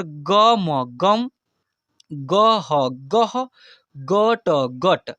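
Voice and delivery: slow, letter by letter, then the word; slow spelling out. Shown as a demonstration. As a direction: spell each sound apart, then join. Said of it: "अब इसको फटाफट से धड़ाधड़ पढ़ते कैसे". (धीरे धीरे अक्षर अलग करके)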